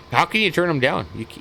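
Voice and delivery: ordinary talk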